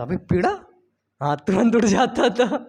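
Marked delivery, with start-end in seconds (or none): 0.0-0.6 put-on sleepy surprised boy. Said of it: "तो मैं बोलता".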